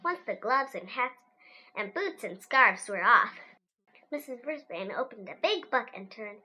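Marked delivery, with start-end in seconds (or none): none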